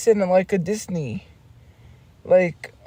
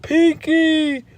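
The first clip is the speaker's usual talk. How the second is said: higher, put-on cartoon voice; cartoon character voice; 7 semitones higher, much slower than usual. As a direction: call "Pinky" in a squeaky cartoon voice. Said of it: "he's like".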